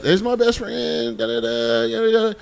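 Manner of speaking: high, drawn-out mock girl voice; mock sing-song.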